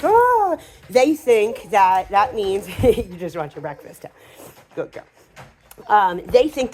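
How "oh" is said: much higher, louder startled "oh"; mock surprised oh. Person backing away from a jumping dog, startled oh. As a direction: pull back in mock surprise. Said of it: "you're like".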